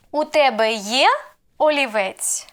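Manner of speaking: є rising sharply, олівець falling after it; question intonation. Shown as a demonstration. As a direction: ask it with the lift on є. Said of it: "the main role plays intonation".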